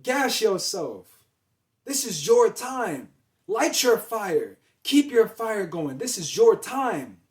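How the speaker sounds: loud, slow, fired-up hype; hyping yourself up. Shown as a demonstration. Coach hyping up the listener with loud, slow fire. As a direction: pump yourself up, every word driven home. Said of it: "Hype yourself up".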